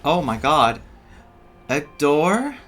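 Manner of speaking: ordinary talk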